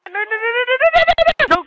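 rapid nos climbing very high; rising squeal of alarm; panicked. Real, not acted. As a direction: pitch shoots up in panic. Panicking during live play.

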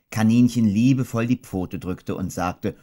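ordinary delivery, nothing marked

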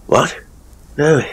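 plain reading voice

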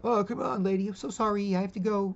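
high, loud wheedling voice; pestering beggar. Retold or imitated. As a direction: nag her, high and loud. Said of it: "then following her up".

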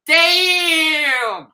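high, loud drawn-out exclamation; Chris Tucker shout. Retold or imitated. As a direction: belt it out like a stunned comic sidekick. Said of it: "Chris Tucker going".

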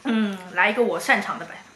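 ordinary delivery

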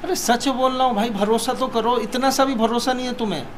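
high-pitched, exasperated plea; earnest protest. Real, not acted. Man begging partner to trust him, exasperated protest.

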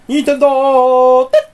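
loud exclamation. Real, not acted. Exclaimed brand name.

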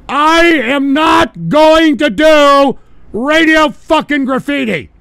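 high, loud, slow yell; emphatic shouted refusal. Real, not acted. Host refusing to do Radio Graffiti, shouting slowly. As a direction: bellow every word drawn out. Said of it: "Let me repeat that one mo again".

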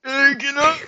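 high, goofy put-on voice; goofy high character voice; shorts user voice. Shown as a demonstration. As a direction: pitch it way up for a silly caller. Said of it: "not the short season verse voice".

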